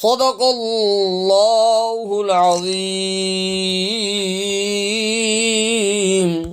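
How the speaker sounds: slow melodic Quran chant; Quranic recitation. Acted. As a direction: chant the verses long and flowing. Full recitation of the verses.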